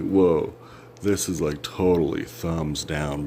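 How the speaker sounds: usual speaking voice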